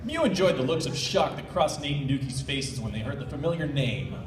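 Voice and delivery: ordinary delivery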